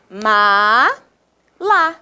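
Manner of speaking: "ma-la" very slowly, split into two beats; syllable by syllable. Shown as a demonstration. Teacher counts on fingers for pupils, syllable by syllable. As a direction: count out "ma-la" one long syllable each. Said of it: "fazer contando com os dedinhos".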